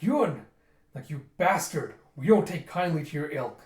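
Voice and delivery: loud, indignant acted scolding; mock angry outburst. Attackers turning on the confessed pirate, angry. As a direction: lash out loudly in character.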